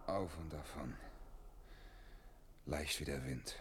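low, hushed murmur; softly watch someone slip away; quiet, low, lost in thought; wistful, to himself. Acted. Watches her vanish, to himself.

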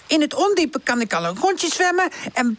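high, boastful little character; high-pitched, pleased-with-himself youngster; proud young Charlie. Acted.

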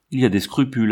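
usual speaking voice

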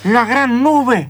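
slow awed echo of the name; ominous repeat. Acted.

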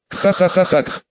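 wet, gurgling cackle; a vile bubbling laugh; gurgling nasty laugh. A narrator's reading. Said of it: "Булькающий противный смех".

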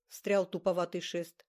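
usual speaking voice

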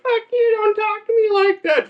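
very high mock old-woman voice; indignant grandma. Comic voicing grandma answering back, indignant high squeal. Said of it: "She's like".

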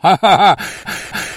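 high mocking biker laugh; cackling laugh. Zombie biker mocking the skeleton, cheeky cackle.

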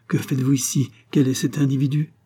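plain reading voice

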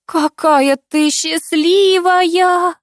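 high, very slow tearful wail; sobbing. A narrator's reading. Aunt to the newly engaged girl, sobbing with emotion. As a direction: drag it out with an acted sob. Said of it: "всхлипнула тётя Тамара".

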